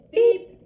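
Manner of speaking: high, loud honk from a little truck; bright pop of a horn, voiced high; little truck beep. A narrator's reading. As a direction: toot a short, high beep. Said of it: "Blue said".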